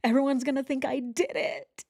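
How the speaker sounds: hammed-up selfish whine; mock self-pity. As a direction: parody her real worry. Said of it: "what else is horrible".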